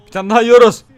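loud, gasping alarm. Real, not acted. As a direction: blurt it out in sudden panic. Sees another goal coming.

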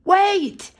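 a high, loud call of Wait. Acted. Chick calls after his runaway brother, shouting high. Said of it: "he called".

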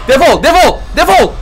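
angry yell. Real, not acted. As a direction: chase it away. Yells at the animatronic. (öfkeyle bağırarak)

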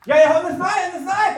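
loud, high shouted denial; Peter. Acted. Disciple denying he knows Jesus, shouting. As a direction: shout it out insistently. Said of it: "Петро кричав".

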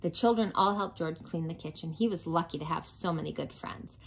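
usual speaking voice

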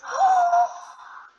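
high loud shocked gasp; sharp dramatic gasp. Real, not acted. Finds no village, dramatic gasp.

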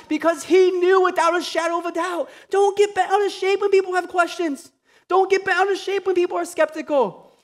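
rising sermon fervor; preaching at full force. Acted. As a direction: preach it hard to the church. Preaching peak.

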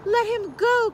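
high, loud mother frog demand; crying out. A narrator's reading. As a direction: shout high for his release. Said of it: "cried Mother".

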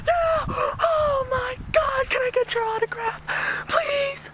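very high, slow screaming fan voice; mock fangirl screaming. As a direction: play a star-struck fan shrieking at the stage. Acting out screaming fan at concert.

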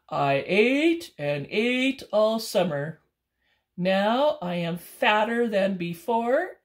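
slow, loud bear voice, drawn out; a drowsy bear who won't budge; sleepy bear. Acted. Bear telling raccoon he will sleep all winter, slow.

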